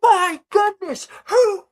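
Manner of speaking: high, loud excited announcer; sports announcer. Acted. Announcer marvels at the newest racer, high thrilled shouting. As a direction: shout it over a roaring crowd. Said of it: "the announcer".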